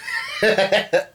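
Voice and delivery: high, giggly cackling; amused laugh. Real, not acted. Laughing at the retold line.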